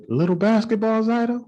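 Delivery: realizing who she is; delighted recognition. Retold or imitated.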